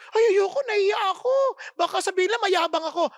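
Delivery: high, whining mock refusal; whiny shy believer. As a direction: ham up a timid church member.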